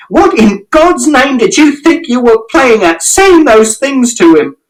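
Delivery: very high, slowing accusing yell; furious shout. A narrator's reading. Mother shouting at father about his lies. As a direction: demand an answer, outraged. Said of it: "And Mother shouted".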